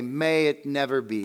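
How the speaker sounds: ordinary talk, nothing marked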